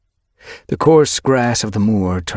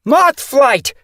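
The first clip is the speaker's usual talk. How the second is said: loud, high, angry call; angry yowl; 8 dB louder than usual. Acted. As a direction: yowl her name in anger. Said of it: "Windrunner's angry yowl".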